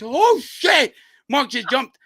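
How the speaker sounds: high, loud retold cry of shock; shocked exclamation. Friend watching Mark backflip off the bridge, loud mock shock.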